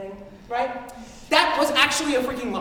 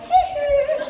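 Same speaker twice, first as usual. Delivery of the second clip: moonwalk hee hee; 13 semitones higher, 6 dB louder than usual. Retold or imitated.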